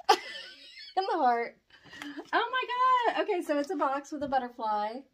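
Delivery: ordinary delivery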